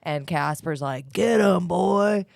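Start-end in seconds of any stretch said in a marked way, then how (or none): 1.1-2.2 ghost voice. Acted. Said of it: "you did kind of a mm voice there".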